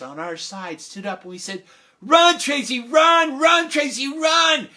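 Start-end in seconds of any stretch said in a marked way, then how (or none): 2.0-4.7 loud high shouting cheer for a runner. Retold or imitated. Crowd in the bleachers urging Tracy to run, shouting. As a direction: yell it from the bleachers.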